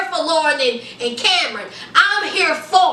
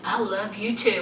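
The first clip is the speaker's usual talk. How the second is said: quiet, lower I love you too; soft reply; 9 semitones lower, 8 dB quieter than usual. Retold or imitated. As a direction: say it back gently. Voicing Cameron's reply.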